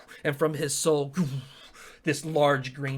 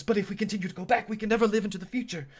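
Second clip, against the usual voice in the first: higher, hurried objection; protesting soldier; 7 semitones higher, much faster than usual. Acted. Second soldier objects to Asteri, high and hurried. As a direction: argue back fast, pitched up.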